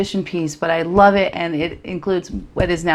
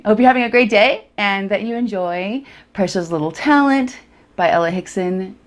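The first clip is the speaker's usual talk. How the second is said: ordinary talk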